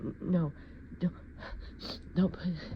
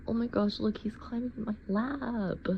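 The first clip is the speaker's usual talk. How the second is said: ordinary talk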